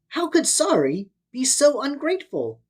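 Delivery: plain reading voice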